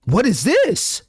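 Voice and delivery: puzzled question, voice jumping up; confused surprise. Acted. Player confused by the eyes message, rising bafflement.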